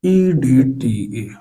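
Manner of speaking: EDTA drawn out letter by letter; slow dictation. Real, not acted. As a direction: spell out EDTA very slowly, stretching each letter. Spelling out term for students writing. (EDTA को एक-एक अक्षर खींचकर धीरे बोलें)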